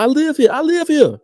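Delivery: high-pitched mock protest; protesting squatter impression. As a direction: imitate a squatter insisting, voice way up high. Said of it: "He was like".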